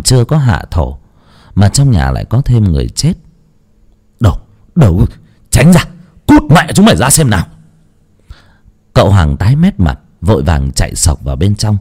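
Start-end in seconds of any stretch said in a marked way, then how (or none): 4.2-7.5 loud, higher, rough command to clear the way; snapped shout, faster at the end; angry shout. Acted. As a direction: bark at the crowd to get out. Master shoving servants aside in panic.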